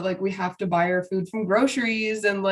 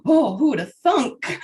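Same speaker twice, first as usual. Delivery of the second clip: gasp, then higher mock astonishment; mock surprise; 5 semitones higher, 4 dB louder than usual. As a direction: feign amazement at the obvious. Mock surprise at obvious solution.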